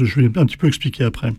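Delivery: ordinary talk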